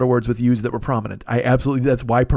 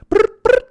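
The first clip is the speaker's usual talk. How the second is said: very high, louder brrr brrr; phone buzz imitation; 6 dB louder than usual. Rap-song phone ring, high buzzing brrr. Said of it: "that's a phone".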